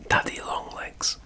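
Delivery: ordinary delivery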